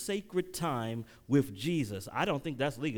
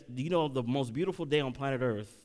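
ordinary talk

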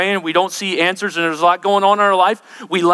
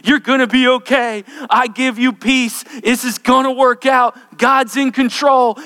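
loud, high fervent preaching; rising excitement; 6 semitones higher, 4 dB louder than usual. Acted. Preacher rejoices over the angel's reassurance, loud. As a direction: lift the room with joy.

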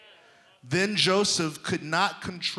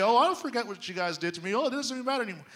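higher, fast, airy brush-off; mock breezy denial; 8 semitones higher, much faster than usual. Preacher mocking a fake forgiving Joseph, breezy mock denial. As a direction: toss it off like nothing happened. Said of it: "He didn't say".

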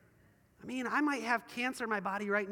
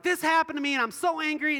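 loud, high complaint to God; angry yelling; 7 semitones higher, 7 dB louder than usual. Acted. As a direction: yell your grievance as a mock protest. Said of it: "we yell at God".

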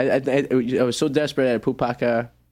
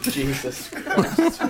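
laughing; 11 semitones higher, 3 dB quieter, much slower than usual. Real, not acted. Shocked at rude word.

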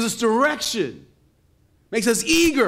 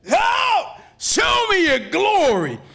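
much higher, louder, slow pleading shout; fervent cry; 12 semitones higher, 5 dB louder, slower than usual. Acted. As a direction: cry out the plea to see God's glory. Moses' prayer, preached loudly.

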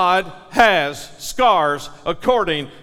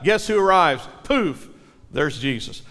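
usual speaking voice

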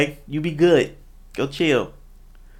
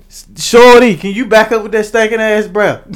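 mock outburst, raised pitch; blunt cashier complaint; 7 semitones higher, 6 dB louder than usual. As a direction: play a put-off cashier complaining.

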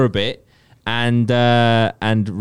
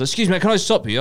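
mock charity collector, pitched higher; imitating charity collector; 8 semitones higher, much faster than usual. Retelling the stranger at the exit, mock high eager voice. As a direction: echo the collector's eager line in a raised voice. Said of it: "I was like".

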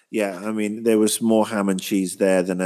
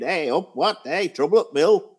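a light mocking Sheffield accent, higher; 7 semitones higher than usual. Critic mocking the showrunner's Sheffield accent, higher. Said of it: "he wants everybody to talk like that".

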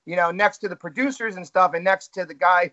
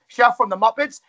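ordinary talk, nothing marked

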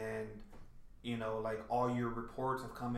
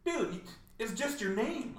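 mock disbelief; 10 semitones higher, slower than usual. Quoting reaction to empty work.

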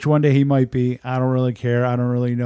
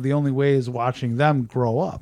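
ordinary talk

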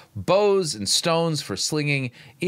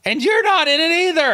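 much higher, much louder retort; incredulous shout; 9 dB louder than usual. Real, not acted. Commentator shouting back at the sermon clip, incredulous. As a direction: fire it back in disbelief.

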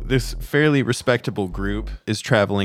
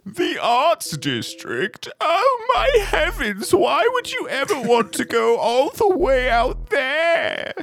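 very high-pitched, drawn-out fussy cabbie; scandalized cab driver; slower than usual. Acted. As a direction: play a horrified cab driver in falsetto. Game master plays the cab driver.

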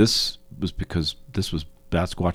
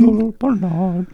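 high stretched name; 5 dB louder than usual. Pretend. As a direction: echo it up high. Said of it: "Should we do a, anytime we, Hwevelman".

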